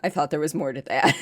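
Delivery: normal talking voice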